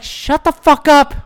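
high, slow, drawn-out retort; fed-up retold yell; exasperated outburst. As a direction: snap back at a heckler. Retold snapping at a heckler.

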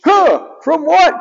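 high dumb cartoon robot; puzzled sidekick in a high voice; high baffled robot sidekick; Grounder's voice. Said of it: "Grounder goes".

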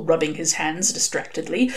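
ordinary delivery, nothing marked

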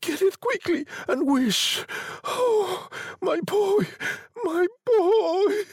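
high-pitched, urgent plea rising to a wail; agitated. A narrator's reading. Wife longing for her dead son, agitated wail. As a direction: plead urgently, crying out high. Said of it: "breathing quickly".

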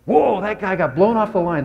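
imitating the coach reacting to game film. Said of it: "Coach Molman ... goes".

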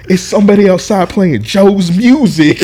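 high, loud, stretched-out imitation; overexcited announcement. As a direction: blurt it like thrilled breaking news. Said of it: "He's excited, bro".

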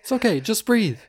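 high panicked mom. Acted. Mom calming dying son.

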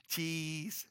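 a soft, stretched photo "cheese"; grandson's cheese. Retold or imitated. Grandson posing on the dirt pile, drawn-out cheese. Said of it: "he goes like this".

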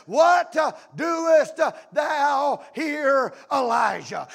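loud, drawn-out exasperated demand, dropping on the name; God's frustration. Acted. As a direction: thunder it slowly, word by word. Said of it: "Can you see the frustration in God's voice?".

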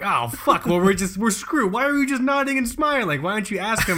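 high, loud comic exasperation; exasperated rant. Retold or imitated. Son berating his dad for nodding along, exasperated. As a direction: build from dismay to high-pitched disbelief.

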